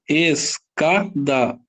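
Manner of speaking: Escada drawn out very slowly, every sound given; slow demonstration. Shown as a demonstration. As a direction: stretch Escada out for the class. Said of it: "que que eu vou fazer".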